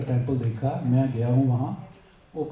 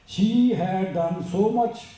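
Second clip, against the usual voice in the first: slow, measured English recitation; formal read-aloud; 5 semitones higher, much slower than usual. Acted. Speaker reading a great woman's story to listeners, slow formal read-aloud. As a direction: read it out word by word, slowly.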